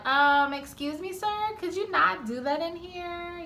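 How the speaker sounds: high, timid polite plea; nicest, weakest voice. Retold or imitated. Driver asks a passenger to stop rolling, nicest weakest voice. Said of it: "in her nicest, weakest voice".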